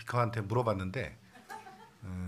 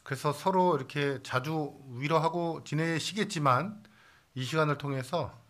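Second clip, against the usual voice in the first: normal talking voice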